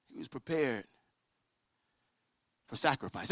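low, very soft repetition; grave, near-whispered preaching; solemn hush. Real, not acted. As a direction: let it land quietly. Same line repeated in a hush.

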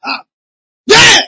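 high, loud put-on cheer; overdone congregant's whoop; rehearsed shout. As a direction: fake an over-eager amen shout. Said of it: "Don't rehearse it".

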